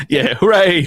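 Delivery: hammed-up celebration; mock cheer. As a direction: cheer it with fake enthusiasm.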